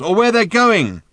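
high, loud shouted question; calling out. A narrator's reading. Arthur asks the old man their destination, calling out. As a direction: holler down to someone below. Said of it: "he called".

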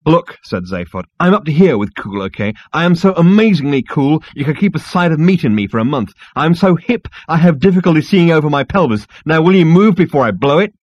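loud, high, boastful rant; loud cocky rant. Acted. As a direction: blast it out full of swagger. Zaphod ranting at insect.

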